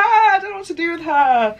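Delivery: high, loud, falling wail; dramatic fretting over hair; exasperated wail. Real, not acted. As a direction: wail it in playful despair.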